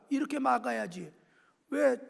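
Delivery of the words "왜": normal talking voice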